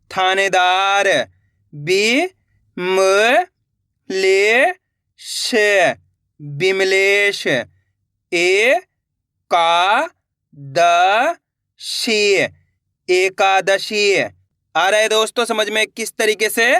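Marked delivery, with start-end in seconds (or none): 1.7-7.5 slow blended reading. Shown as a demonstration. Teacher showing pupils how to blend, said slowly. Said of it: "एक बार फिर से देखिए". 7.5-14.1 slow blended reading. Shown as a demonstration. Teacher showing pupils how to blend, said slowly. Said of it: "एक बार फिर से देखिए".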